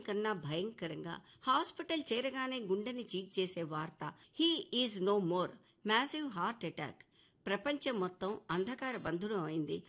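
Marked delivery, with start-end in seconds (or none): 4.4-7.0 slow grave news. Acted. Doctor breaks the death news.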